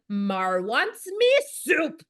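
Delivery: put-on slow pouty little-kid voice talking about herself; hammed-up Sesame Street toddler. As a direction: drag it out like a sulky three-year-old. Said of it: "she sounded like a Sesame Street character".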